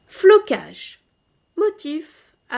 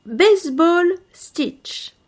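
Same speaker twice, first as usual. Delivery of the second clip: slow, loud "Baseball stitch" in English; English word; 6 semitones higher, 7 dB louder, slower than usual. Shown as a demonstration. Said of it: "headword in English". ("Baseball stitch" prononcé à l'anglaise, lentement et fort)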